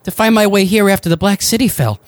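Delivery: lightly voiced Joshua. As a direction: pitch up a little, hopeful.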